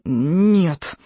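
strained, drawn-out muffled refusal; muffled mooing. A narrator's reading. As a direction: moan it out as if squashed. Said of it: "мычал Икинг".

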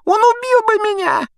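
very high, loud squealing whine; Pettigrew whimpering. A narrator's reading. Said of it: "проскулил Петтигрю". (громкое тонкое скуление)

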